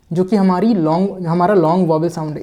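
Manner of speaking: normal talking voice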